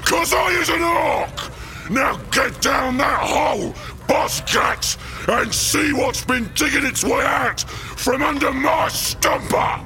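high, roaring orc boss; bullying orc bellow. Acted. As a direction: bellow orders at a cowering underling.

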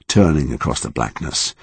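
plain reading voice

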